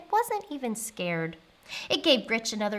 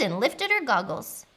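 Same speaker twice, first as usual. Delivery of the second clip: usual speaking voice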